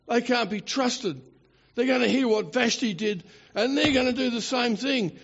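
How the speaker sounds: high-pitched, hammed-up worry; mock alarm. Adviser fears wives will copy the queen, mock alarmed. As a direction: warn urgently, playing the worried adviser.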